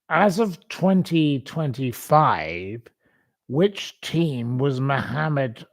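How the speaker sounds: slow, deliberate quizmaster delivery; quiz host read. Acted. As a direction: read the question out slowly like a game host. Reads out a quiz question.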